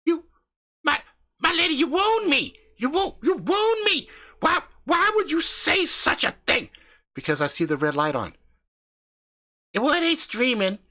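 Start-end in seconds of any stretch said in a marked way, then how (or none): 0.1-6.7 high hammed-up theatrical lament; high, slowed mock-tragic voice; mock wounded. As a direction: play the wronged gentleman, overdone. Mock wounded theatrical reply to wife.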